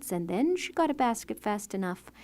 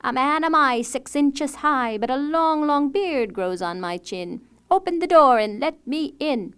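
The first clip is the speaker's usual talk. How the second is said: dwarf calling at the door; 5 semitones higher, 8 dB louder than usual. A narrator's reading. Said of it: "Longbeard knocked".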